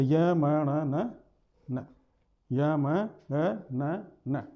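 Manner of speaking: य म ण न recited very slowly, letter by letter; teacher recites the nasal series slowly; slow drawn-out recitation. Shown as a demonstration. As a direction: stretch each letter य म ण न out. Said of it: "यम गणना नाम नासिका से".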